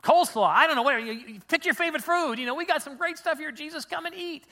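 high-pitched, pushy friendly offer; eager coaxing. Acted. Disciples offering Jesus their food, eager coaxing.

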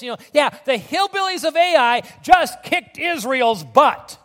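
high, loud, hammed-up alarm; worked-up ranting. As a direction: spread scandalous news at full voice. Acts Joshua's alarm and gossiping enemies.

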